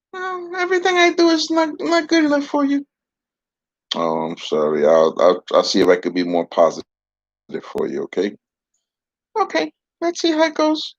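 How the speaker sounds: a pouting dummy complaining; puppet voice. Acted. Puppet sulks.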